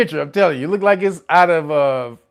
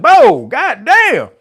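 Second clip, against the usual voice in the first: loud slow drawn-out exclamation; shocked; 7 semitones higher, 6 dB louder, slower than usual. Retold or imitated. Scrolling and seeing the two-headed cow, stunned drawn-out cry. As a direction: stretch out your shock at the photo. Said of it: "and I was like".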